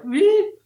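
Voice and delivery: cartoon boing-like squeal for hair standing up; playful squeal. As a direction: squeal like a spring popping up. Hair springing up, imitated.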